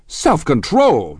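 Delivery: high, loud, drawn-out disbelief; incredulous. A narrator's reading. Husband repeats his wife's plea, incredulous. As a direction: throw it back as an outraged question. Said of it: "repeated Tom incredulously".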